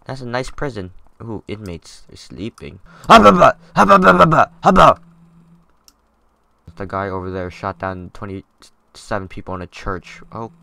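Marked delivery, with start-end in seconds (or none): 3.1-5.0 loud, high goofy hubba hubba; hubba hubba yell. Pretend. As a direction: holler it like a cartoon wolf. Goofing over sleeping inmates.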